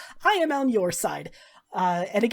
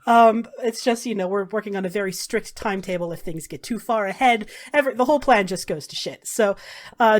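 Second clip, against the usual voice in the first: normal talking voice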